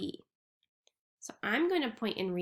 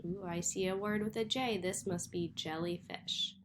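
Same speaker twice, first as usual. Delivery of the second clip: ordinary delivery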